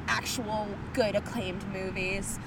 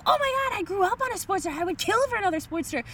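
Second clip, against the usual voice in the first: high-pitched mock gushing; hammed-up excited imitation; Ray Liotta; 8 semitones higher, faster than usual. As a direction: gush like a thrilled biker. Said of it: "Ray Liotta's like".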